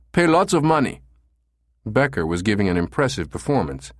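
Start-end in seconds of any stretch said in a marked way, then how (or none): 0.1-1.0 put-on very high, pleading German-accented voice. Caller pretends to want an escort, high German accent. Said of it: "in his German accent".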